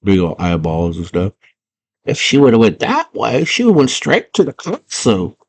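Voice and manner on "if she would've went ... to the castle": high creature aside; little worm voice. Acted. Worm commenting after the girl leaves, high voice. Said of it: "And the worm's like".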